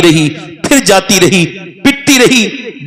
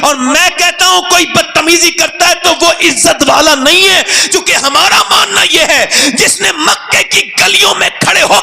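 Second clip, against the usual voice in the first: fiery preaching; 10 semitones higher than usual. Acted. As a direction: build the crowd to a shouted climax. Preacher rises to full force. (جوش سے بلند آواز میں خطابت)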